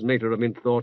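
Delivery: ordinary delivery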